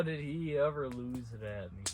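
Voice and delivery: ordinary talk, nothing marked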